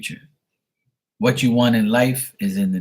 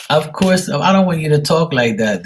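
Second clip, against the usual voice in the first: playful scolding; 6 semitones higher, 5 dB louder than usual. Acted. Playful scolding of reading subject.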